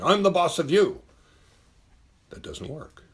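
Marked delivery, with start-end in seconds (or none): 0.0-1.0 much higher and louder bossy shout; domineering boss yell. Retold or imitated. A bad leader bursting in, shouting loud. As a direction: bark it like a bully boss. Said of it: "slamming open the door and saying".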